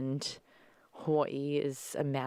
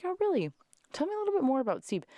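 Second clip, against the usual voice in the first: much higher, fast fake enthusiasm; mock interest; 13 semitones higher, 3 dB louder, much faster than usual. Daughter teasing dad with high mock interest. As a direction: gush with pretend curiosity. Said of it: "I'm like".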